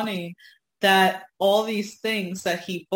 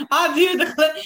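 Foghorn Leghorn impression; 9 semitones higher, 7 dB louder than usual. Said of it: "I loved Foghorn Leghorn".

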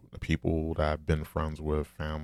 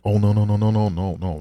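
loud, high burst of no's in mock protest; emphatic protest; 5 semitones higher, 10 dB louder than usual. People he calls hypocrites backpedalling, loud and high. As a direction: fling the no's out like a horrified denial. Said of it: "they're like".

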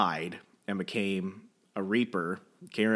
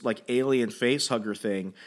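usual speaking voice

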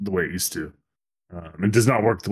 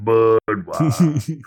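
slow, drawn-out Cajun Yoda voice; hammed-up Yoda calling out Budweiser; mock Yoda mama; 4 dB louder, much slower than usual. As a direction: stretch the brand name like a swamp creature. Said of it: "Your mama Yoda over there going".

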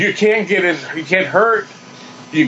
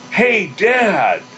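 retold yell; 5 semitones higher than usual. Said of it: "yell out at the top of my lungs".

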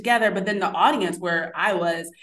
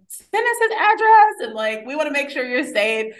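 worried family; 7 semitones higher, 5 dB louder than usual. Retold or imitated. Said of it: "friends were more afraid".

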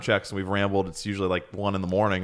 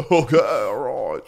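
morning voice; 4 semitones higher, 5 dB louder than usual. Retold or imitated. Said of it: "It's his morning voice".

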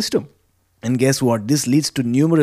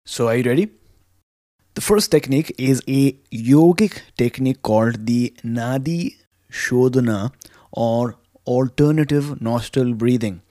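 ordinary talk, nothing marked

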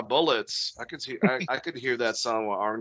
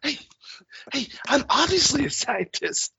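Mark Wahlberg impression; 12 semitones higher than usual. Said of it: "Mark Wahlberg guy".

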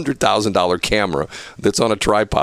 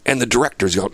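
usual speaking voice

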